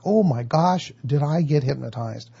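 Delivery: ordinary talk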